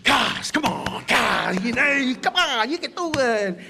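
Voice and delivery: slow, loud coaxing push; a mate coaxing a singer who keeps missing notes, loud and encouraging; encouraging coach. Retold or imitated. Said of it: "he's like".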